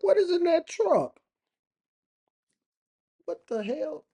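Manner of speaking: high-pitched, loud hillbilly bewilderment; hammed-up baffled yokel; gawking country local. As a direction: stare and holler in mock astonishment, calling a buddy over. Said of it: "They be looking at me like".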